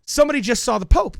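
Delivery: excited rumor. Retold or imitated. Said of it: "And somebody says".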